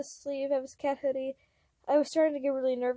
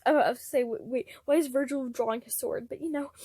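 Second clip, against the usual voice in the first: plain reading voice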